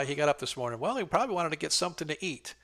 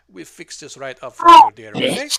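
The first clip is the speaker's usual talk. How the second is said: much louder, higher chirpy reassurance; cheerful old nurse; 7 semitones higher, 19 dB louder than usual. Acted. Midwife patches the player's wound, loud and chirpy. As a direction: chirp it brightly after yanking the thorn. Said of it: "She goes".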